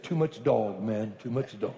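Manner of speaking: low-pitched, lightly imitated Jamaican accent. Bishop back in the car after dogs, light Jamaican accent. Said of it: "in that rich Jamaican accent".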